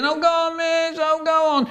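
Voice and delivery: high whiny begging; child pleading with the teacher. Retold or imitated. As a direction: wheedle the teacher.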